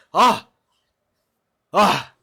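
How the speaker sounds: loud high yelp of glee; loud gleeful ah; excited yell. Real, not acted. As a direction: shout out with glee.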